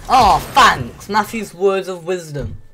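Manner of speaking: mock gratitude, laid on thick; sarcastic. Real, not acted. Gamer answers friend's useless advice, sarcastic. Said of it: "something called sarcasm".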